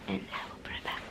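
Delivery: real hushed whisper to the camera. Real, not acted. Vlogger whispering to viewers at home, real whisper. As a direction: drop to a breathy whisper so no one wakes. Whispering near sleeping others.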